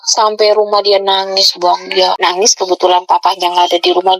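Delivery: ordinary talk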